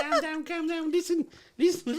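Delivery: put-on high, laughing Scouse accent; squeaky Liverpool Scouse, giggling through it. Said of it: "do a Scouse accent".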